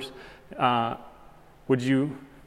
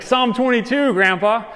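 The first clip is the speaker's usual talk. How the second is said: higher, questioning reminder to Grandpa; raised pitch, rising as a question; incredulous prompting; 7 semitones higher than usual. Retold or imitated. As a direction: prompt him as if he must know this. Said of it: "I'm like".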